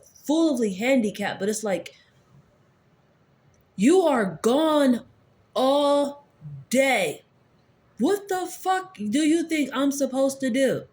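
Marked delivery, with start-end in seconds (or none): 3.8-10.8 slow, higher and louder, each word pulled out; exasperated retort. Retold or imitated. Snapping back at the aunt who leaves all day, slow and exasperated. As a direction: drag out all day in disbelief.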